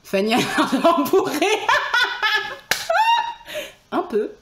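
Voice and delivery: talking through laughter; laughing. Real, not acted. Streamer laughing about a tipsy viewer. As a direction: giggle between the words.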